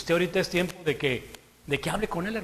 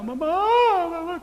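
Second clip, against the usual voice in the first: put-on high, wailing "Mamá" like a scared little child; crying like a baby; 11 semitones higher, much slower than usual. Dying tough cousin wailing for his mother like a baby. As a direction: cry out for mama like a helpless baby. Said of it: "como un bebé".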